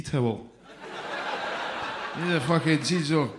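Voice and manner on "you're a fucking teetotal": ordinary talk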